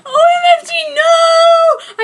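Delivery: high, loud shriek through laughter; laughing mock scream of OMFG no; shout. A narrator's reading. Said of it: "I shouted".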